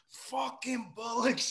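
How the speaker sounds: slow, high British grumble. Retold or imitated. [name] cursing the waiter over no champagne, slow British grumble. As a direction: drag out the curse in a mock Brummie twang. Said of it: "He's like".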